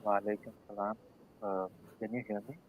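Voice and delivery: faint, distant mic; barely audible. Real, not acted. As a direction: far from the microphone. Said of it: "your voice is very low".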